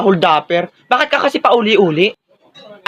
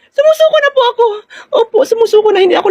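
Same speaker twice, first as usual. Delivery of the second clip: mock surrender. Surrendering in game.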